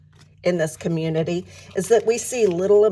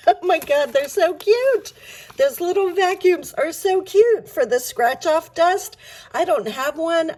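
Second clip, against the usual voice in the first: gushing; 9 semitones higher, 3 dB louder than usual. Real, not acted. Gushing over cute vacuums.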